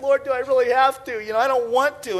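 whiny protest. Retold or imitated. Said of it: "I'm like".

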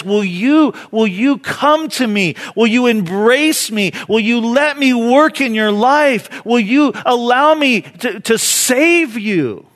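high, loud, urgent plea; crying out. Acted. Jesus asking you to come to him, crying out. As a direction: preach it as an impassioned appeal. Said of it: "That very cry. He says".

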